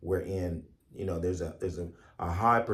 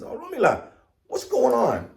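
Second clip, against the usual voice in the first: high, louder dismayed cry; alarmed Ogun; 13 semitones higher, 4 dB louder than usual. Acted. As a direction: demand an answer in alarm. Ogun shocked at dissolved weapons.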